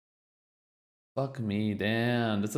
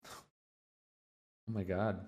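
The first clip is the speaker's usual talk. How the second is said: slow, drawn-out choked-up sigh of words; a real lump in the throat; suddenly emotional; 4 dB quieter, much slower than usual. Real, not acted. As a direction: caught off guard by real feeling. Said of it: "I don't know why I got emotional".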